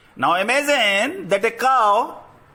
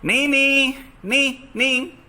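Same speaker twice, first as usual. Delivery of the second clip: horse neigh; 2 semitones higher, slower than usual. Shown as a demonstration. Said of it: "Now the horse".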